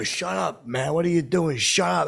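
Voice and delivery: high, loud retold scolding; annoyed friends. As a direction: imitate buddies shushing him urgently. Friends angrily silence him in story.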